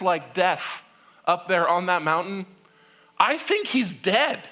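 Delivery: worried Israelites. Acted. People worrying Moses died.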